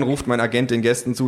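usual speaking voice

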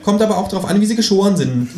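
high kangaroo voice; high, critical kangaroo; displeased kangaroo. Acted. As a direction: judge the dog's flight. Kangaroo continues.

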